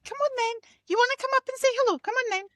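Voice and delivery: sing-song coaxing to a dog; pet voice. Real, not acted. Coaxing Darwin the dog up for the camera, high pet voice.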